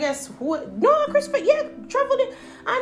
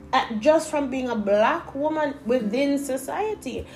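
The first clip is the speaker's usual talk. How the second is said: ordinary talk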